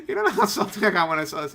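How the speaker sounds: fast, laughing confession. Real, not acted. Host admitting how salty he got, laughing. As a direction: rush it out through laughter. Cracks up confessing his reaction.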